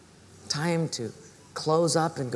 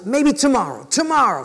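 louder, higher brush-off; hurried put-off; 5 semitones higher, 6 dB louder than usual. Acted. Cobbler putting off an old friend, hurried. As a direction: fend them off with a raised voice.

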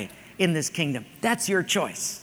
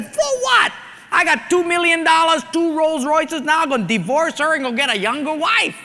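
high and loud, crowing; brash bragging; 8 semitones higher, 3 dB louder than usual. Acted. Husband brags high and loud to the genie. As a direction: brag as if he has won.

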